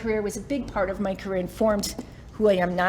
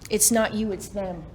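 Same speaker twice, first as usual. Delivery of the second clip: ordinary talk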